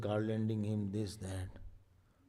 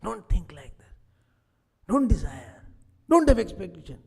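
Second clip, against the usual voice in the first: louder, rising scolding commands; insistent inner voice; 7 semitones higher, 9 dB louder than usual. Acted. God inside pressing the listener, growing louder. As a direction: urge firmly, building up.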